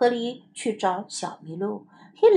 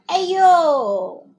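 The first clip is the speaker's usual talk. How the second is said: loud yelp of pain in Chinese; 痛得大叫一声; 5 semitones higher, 5 dB louder than usual. A narrator's reading. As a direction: cry out as if pricked. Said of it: "他叫了起来".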